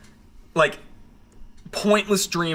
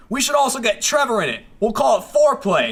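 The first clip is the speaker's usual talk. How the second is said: high, eager impression of a friend; Gavin; 7 semitones higher, 3 dB louder than usual. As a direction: pitch it up, pitching an idea excitedly. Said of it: "then Gavin goes".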